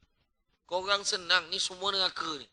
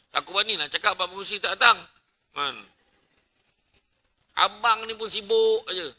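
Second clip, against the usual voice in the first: ordinary talk, nothing marked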